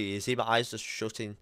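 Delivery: ordinary talk, nothing marked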